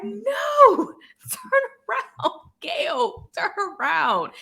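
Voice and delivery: high-pitched pleading mock yell; re-enacted yelling at TV. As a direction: plead with the screen, high, dropping at the end. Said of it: "I'm literally yelling at the T V like".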